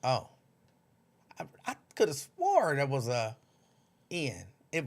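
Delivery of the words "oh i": usual speaking voice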